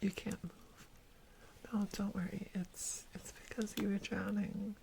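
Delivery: soft, close whisper to the listener; ASMR whisper. Acted. To the rescued stranger, a soft reassuring whisper. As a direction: soothe them with a gentle hush.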